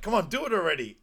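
high, loud acted impatience; impatient urging. Urging him to kill the pig, high impatient prodding.

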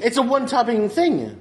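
high, exasperated protest; real comic exasperation. Real, not acted. Exasperated by trivial pizza questions.

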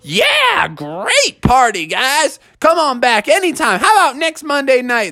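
high "yeah", then stretched enthusiasm; upbeat Froggy. Acted. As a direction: invite everyone back brightly. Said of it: "Froggy's like".

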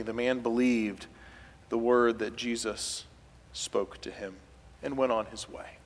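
ordinary delivery

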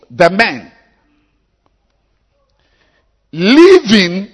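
very high, loud shouted scripture reading; high-pitched pulpit shout; preaching at full force. Acted. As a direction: belt out the verse, stretching the key words.

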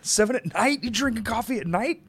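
high incredulous disbelief; shocked, pitch jumping up high; surprised. Real, not acted. Shocked at coffee at night.

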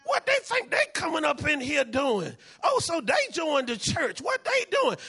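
high, indignant mock outrage; jealous church member. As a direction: scoff at the newcomer in a shrill mock voice. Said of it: "we like".

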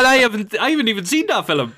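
high, helpless laughing voice. Real, not acted. Cracking up over a film never seen, laughing. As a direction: say it through real laughter.

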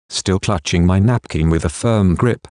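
ordinary delivery, nothing marked

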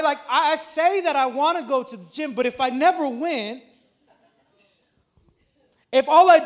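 rising, loud preacher's build; preaching. Acted. Pastor urging the church to act, preaching at full force. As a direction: hammer the point home to the congregation. Preaching on saying versus doing.

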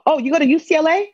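high, loud mock astonishment; imitated surprise. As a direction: exclaim it high as an astonished stranger. Imitating stranger surprised she attends.

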